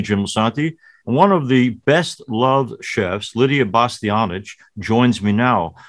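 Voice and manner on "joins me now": usual speaking voice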